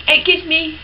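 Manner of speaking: ordinary talk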